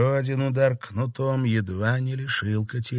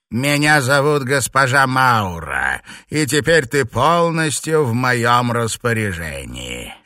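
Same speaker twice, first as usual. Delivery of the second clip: loud, high-handed villainess; haughty old witch making a forceful introduction; imperious witch mistress; 5 semitones higher, 5 dB louder than usual. Acted. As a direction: proclaim your authority over the novice. Witch mistress instructs her captive.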